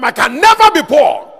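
full-force, high-pitched shout of faith; bold declaration. Retold or imitated. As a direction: proclaim it at the top of your voice. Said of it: "We hear him say all the time".

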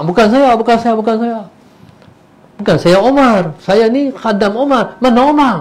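high, loud, frantic protest; panicked denial. Acted. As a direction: desperately wave off the mistake. Servant mistaken for Umar panics.

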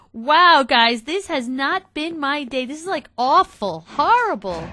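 real exasperated lament; loud dismay. Real, not acted. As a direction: wail to the viewers about a terrible day. Bemoaning bad luck to viewers.

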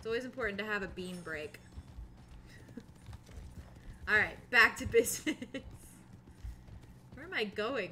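usual speaking voice

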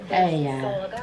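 ordinary delivery

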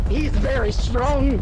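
high, loud, slow wildlife host; awed documentary commentary, hammed up; mock nature narrator. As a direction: narrate a mighty beast, drawn out.

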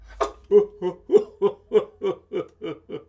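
loud mock whimpering sob; fake sob-laugh. Anansi pretends to cry.

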